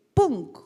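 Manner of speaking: loud, sudden vocal "boom"; golpe repentino imitado. Shown as a demonstration. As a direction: burst out with a punchy impact sound. Sudden illumination imitated by a sound. (¡pum! fuerte y repentino)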